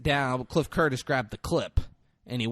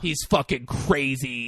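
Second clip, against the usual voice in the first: ordinary talk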